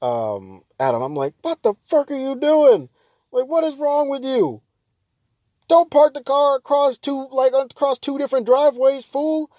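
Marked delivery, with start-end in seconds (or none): 1.4-9.5 high, loud imagined yell at the driver; mock yell out the window. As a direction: chew out the bad parker from the window. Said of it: "I wanted to yell out the window".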